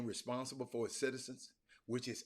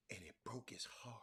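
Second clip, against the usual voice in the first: sad whisper; 9 dB quieter than usual. Real, not acted. Sad turn in the story.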